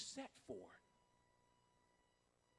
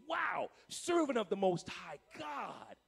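loud, slow, drawn-out admiration; awed acclaim; 5 dB louder, slower than usual. Acted. People marveling at a surrendered believer, loudly awed. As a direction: proclaim it with grand amazement.

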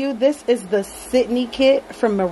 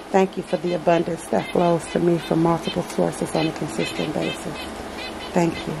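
quiet affirmation; 4 semitones lower, 4 dB quieter than usual. Acted. Speaks a money affirmation mid-unboxing.

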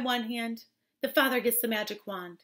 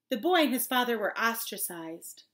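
plain reading voice

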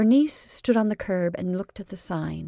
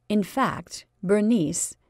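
ordinary delivery, nothing marked

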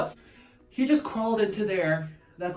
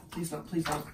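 quiet pleading; 3 semitones lower, 8 dB quieter than usual. Real, not acted. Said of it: "begging cat quietly".

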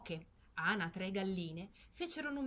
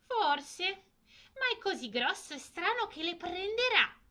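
higher, louder, snippy retort; irritated ducks; 10 semitones higher, 6 dB louder than usual. Acted. Spiteful ducks mocking the ugly duckling, irritated. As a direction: snap back with irritation. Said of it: "ribatterono le anatre stizzite".

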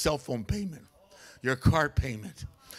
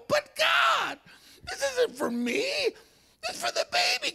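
pleading cry; slower than usual. Retold or imitated. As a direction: wail it up at God. Pleading with God in story.

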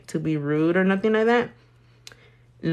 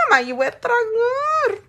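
high excited quote. Retold or imitated. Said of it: "I'm like".

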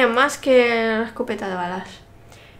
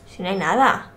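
usual speaking voice

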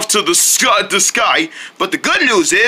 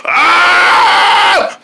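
loud, high, long cartoon scream; hammed-up yell of alarm; 10 semitones higher, 5 dB louder than usual. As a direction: scream as if snatched up. Owl grabbed by chicken.

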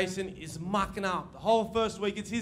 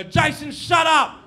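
put-on higher, louder snap at the kid; sharp teacher put-down; 5 semitones higher, 7 dB louder than usual. Teacher to mucking-up student, loud put-down. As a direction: cut him off loud and cutting. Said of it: "I cut, I go".

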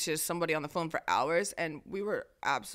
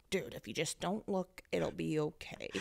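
soft, slow, careful calming; hushed self-reassurance; 2 semitones lower, 8 dB quieter, slower than usual. Retold or imitated. Retelling hiding from the security guy, hushed slow reassurance. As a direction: soothe yourself quietly. Acted self-soothing while being watched.

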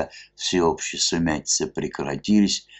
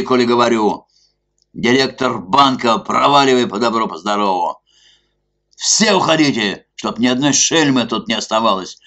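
loud, higher, rowdy bellowing; angry shouting; 6 semitones higher, 7 dB louder than usual. A narrator's reading. Drunk masked man ordering the club officials out, bellowing. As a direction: throw them all out with a roar.

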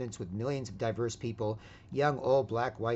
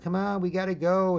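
slightly raised, nagging call; imitated impatient mom; 8 semitones higher, 7 dB louder than usual. Mom tells her boy it is time to leave, mock nagging. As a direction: voice a mom hurrying her child along. Mother urging child to leave.

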